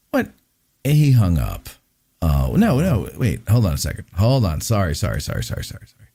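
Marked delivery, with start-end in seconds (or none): 4.6-6.1 muttered apology. Real, not acted. Hung up by accident.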